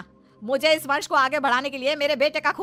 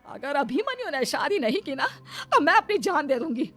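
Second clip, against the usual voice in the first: worked-up, tearful ultimatum; emotional blackmail; 3 semitones higher than usual. Acted. Mother forcing her son to marry the maid, emotional blackmail. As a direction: pressure him with a dramatic threat.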